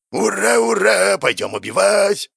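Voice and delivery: high, slow gleeful whoop; gleeful cheering. Acted. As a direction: cheer it out like a giddy child. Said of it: "хлопал в ладоши гнев".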